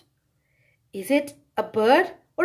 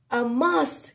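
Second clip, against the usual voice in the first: plain reading voice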